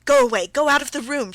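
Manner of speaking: very loud, high shriek; shrieking shrilly. A narrator's reading. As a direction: scream him out of the room. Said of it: "she shrieked, still more shrilly".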